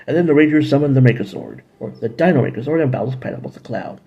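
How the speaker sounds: ordinary talk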